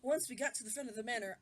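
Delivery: usual speaking voice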